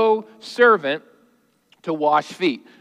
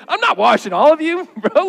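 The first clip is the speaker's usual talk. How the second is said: high, loud, laughing casual brush-off; casual Jesus; 8 semitones higher, 3 dB louder than usual. Acted. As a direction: toss it off like an amused friend. Jesus in a comic, casual retelling.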